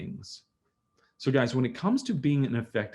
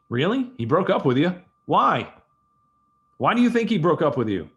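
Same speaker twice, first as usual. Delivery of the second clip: louder, higher prying questions; probing questions; 5 semitones higher, 6 dB louder than usual. Shown as a demonstration. Dad probing his crying daughter, louder and higher. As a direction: press her for the reason. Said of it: "And I probe".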